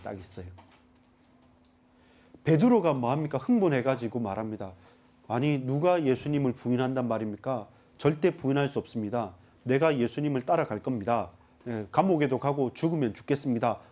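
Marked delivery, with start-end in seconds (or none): none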